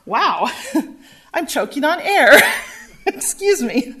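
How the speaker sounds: real laughter breaking between phrases; flustered, laughing after a choking fit. Real, not acted. Presenter apologizing to the meeting for choking, laughing it off.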